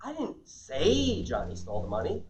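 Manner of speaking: "say" stretched out long, rising at "money"; slow down and lean hard on "say"; stress on SAY. Shown as a demonstration. Trainer showing a second implied meaning, stress on SAY. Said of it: "meaning I may have implied it".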